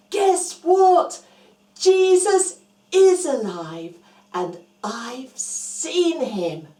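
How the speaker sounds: loud, eager shout of good news; excited news. Acted. Mary telling the friends, loud excited announcement. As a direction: burst in with the big news.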